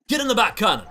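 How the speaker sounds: loud, high captain command; shouted order. Acted. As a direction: order them into the cannon. Captain repeats cannon order.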